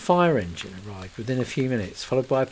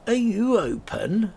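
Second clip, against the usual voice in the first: mild call through a door; 11 semitones higher, 4 dB louder, slower than usual. Acted. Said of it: "shouted round the door".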